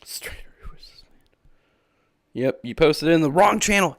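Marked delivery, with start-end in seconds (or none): 0.0-2.4 slow, squeaky whisper; mock-baffled hush. Mock bewildered reaction.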